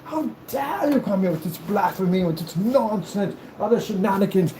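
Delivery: high, theatrical mock outrage; drawn-out, hammed-up indignation; outraged nobleman. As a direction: declaim it like an insulted gentleman. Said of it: "And I'm like".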